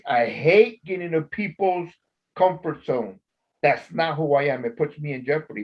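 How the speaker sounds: ordinary talk, nothing marked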